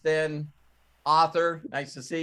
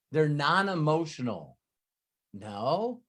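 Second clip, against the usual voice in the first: usual speaking voice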